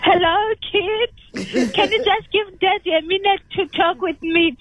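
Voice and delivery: normal talking voice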